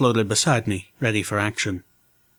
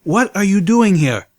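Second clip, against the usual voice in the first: louder, higher stern demand; 8 semitones higher, 4 dB louder than usual. Acted. Lead mare repeats, louder.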